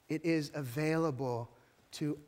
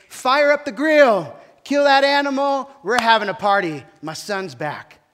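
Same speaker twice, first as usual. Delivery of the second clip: joyful shout; 8 semitones higher, 18 dB louder than usual. Acted. Father calling servants for his son's feast, joyful shout. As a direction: call the servants like a thrilled father.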